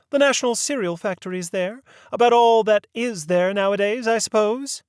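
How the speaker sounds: put-on a proper old lady leading the inquiry; prim lady voice. A narrator's reading. Mary Mabel names the factory.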